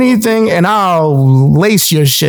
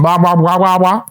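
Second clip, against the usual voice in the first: mock babble. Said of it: "That's what it sounds like".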